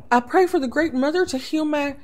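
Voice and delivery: put-on a girl fumbling a lie; nervous young princess. Princess lies to abbess.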